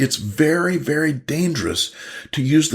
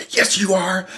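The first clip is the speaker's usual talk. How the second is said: higher-pitched accusing comeback; mock bickering; 7 semitones higher than usual. Wife contradicts husband in a quarrel, mock bickering. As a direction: play an insistent contradiction, pitched up.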